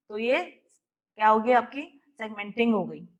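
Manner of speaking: ordinary talk, nothing marked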